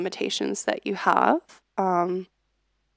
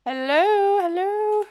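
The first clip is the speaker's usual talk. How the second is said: high sing-song; 10 semitones higher, slower than usual. Real, not acted. Streamer testing raid call.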